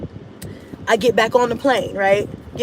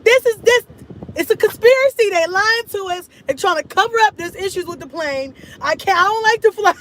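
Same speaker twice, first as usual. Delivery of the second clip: panicked; 8 semitones higher than usual. Retold or imitated. To Cash's dad, panicking that the plane is broken. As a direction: voice climbing with panic, pitched way up. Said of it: "I'm like".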